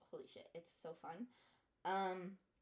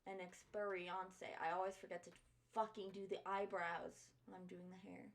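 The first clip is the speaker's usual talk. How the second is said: ordinary talk, nothing marked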